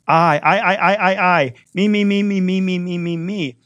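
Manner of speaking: high, insistent run of repeated words; mock self-centred. Preacher showing the pattern in the saying, mock self-centred. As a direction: imitate someone harping on themselves.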